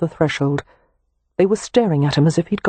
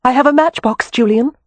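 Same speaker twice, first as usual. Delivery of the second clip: ordinary delivery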